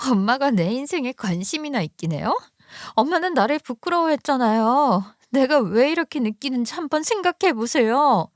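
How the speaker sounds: acted hurt and resentment; hurt daughter. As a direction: reproach your mother without raising volume. Narrator voices hurt sister-in-law.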